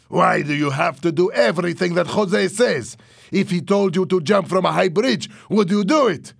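high, loud, incredulous questioning; heated, mocking demand; angry challenge. A narrator's reading. Louis challenges Fernando angrily.

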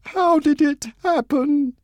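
high, slow, tearful question; a daughter asking through tears. Acted. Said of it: "tears running down her face".

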